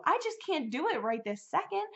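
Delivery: high-pitched plea, pressed upward; pleading. Retold or imitated. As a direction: lift it high, begging for time. Retold plea, high take.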